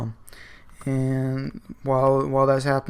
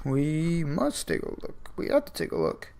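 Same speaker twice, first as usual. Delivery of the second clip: creepy Joker whisper; 3 semitones higher, 6 dB quieter than usual. Retold or imitated. Joker urging Roger to open the mail, creepy whisper. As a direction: hiss it like a lurking villain urging him on.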